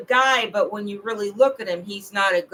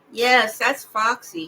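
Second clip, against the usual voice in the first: ordinary talk, nothing marked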